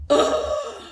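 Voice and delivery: mock disgusted grunt; high exasperated grunt. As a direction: imitate a man's annoyed grunt. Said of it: "I hear this man go".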